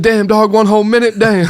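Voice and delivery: high, exaggerated put-on voice of a prank caller. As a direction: ham up a fake street voice.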